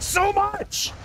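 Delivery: sudden loud, drawn-out cry of exasperation; frustrated yell. Real, not acted. Gamer hating the fight, frustrated yell.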